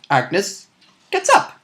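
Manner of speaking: ordinary delivery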